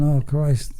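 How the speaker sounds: normal talking voice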